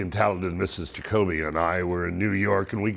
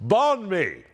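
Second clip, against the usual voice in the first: high-pitched retold yell; mock yell; 9 semitones higher than usual. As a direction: call it out over the din, pitched way up. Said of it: "And she yells".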